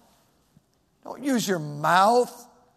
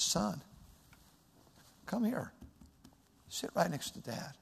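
much softer, calm call to a son; gentle father; 2 semitones lower, 13 dB quieter than usual. Shown as a demonstration. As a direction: invite the child close, tenderly. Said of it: "it's not like this".

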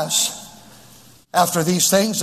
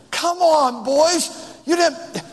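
much higher, incredulous appeal; exhorting; 9 semitones higher than usual. Real, not acted. Preacher challenging boys about marrying their mother, high exhortation. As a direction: cry it out high to the crowd. Preacher calling out the young men.

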